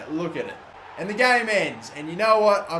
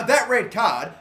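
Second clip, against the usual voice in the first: ordinary talk, nothing marked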